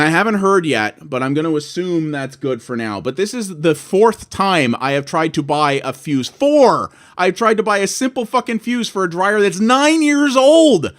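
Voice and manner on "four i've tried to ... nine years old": indignant rant. Real, not acted. Ranting about the fuse.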